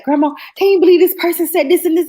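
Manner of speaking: high, loud, indignant gossip; re-enacted venting. Granddaughter retelling how she vented to grandma, high and worked up. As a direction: replay an outraged report to grandma.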